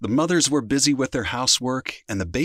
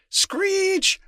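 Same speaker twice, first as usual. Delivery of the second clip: very high screech word; high-pitched imitation of screeching wheels. A narrator's reading. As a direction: squeal it like brakes. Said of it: "the screeching of the tractor and the truck".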